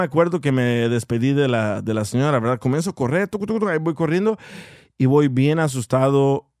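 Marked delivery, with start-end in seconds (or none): 3.3-3.7 quick patter of nonsense syllables; mimicked running footsteps. Boy fleeing the church lady, quick mimicked footsteps. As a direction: rattle off little running-feet sounds.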